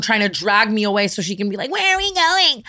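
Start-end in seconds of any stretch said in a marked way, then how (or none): 1.7-2.6 very high whiny friend imitation; mock whining. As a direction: whine it like a clingy drunk. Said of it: "biggest pet peeves in the entire world is whiny tone".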